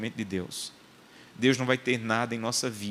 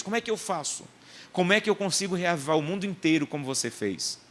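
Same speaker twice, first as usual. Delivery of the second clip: high, eager pleading voice; eager young man; 7 semitones higher than usual. Retold or imitated. Young man begging John Wesley for revival, eager pleading. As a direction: beg for the secret of revival.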